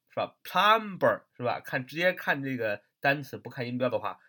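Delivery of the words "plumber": "plumber" drawn out with an audible b; mispronounced plumber. Shown as a demonstration. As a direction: wrongly sound the b in "plumber", plum-ber. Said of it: "很容易读成". (把 plumber 误读成带 b 音的 plum-ber)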